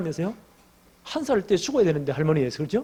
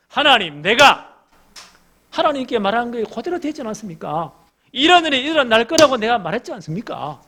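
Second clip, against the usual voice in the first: loud, high, indignant outburst at God; Jonah's angry protest; 7 semitones higher, 10 dB louder than usual. Acted. Jonah arguing with God, loud angry protest. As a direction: shout the complaint up at God.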